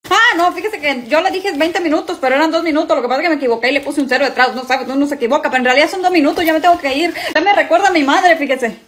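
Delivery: high, fast, flustered escape; nervous rushed excuses. Acted. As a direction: babble your way out fast. Father fleeing the date nervously.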